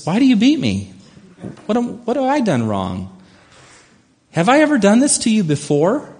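high-pitched, put-upon donkey voice; talking donkey. Acted. Donkey questioning the master who beat it, high-pitched voice. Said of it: "the donkey speaks".

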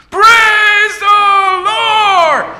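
long, high, ringing shout; screamed praise. Acted. Old lady screams praise, waking the neighbours, long high shout. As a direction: stretch the praise out to the heavens. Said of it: "She says".

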